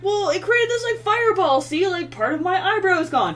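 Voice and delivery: high, loud whiny protest; mock the roommate's self-pity; whiny excuse. Roommate whining to flatmate about lost eyebrow. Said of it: "he was just like".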